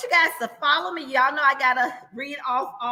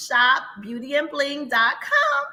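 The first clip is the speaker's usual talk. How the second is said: ordinary talk, nothing marked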